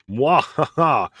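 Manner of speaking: slow, loud evil cackle; mad scientist. Pretend. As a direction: cackle like a gleeful villain. Villain laugh over berserkers.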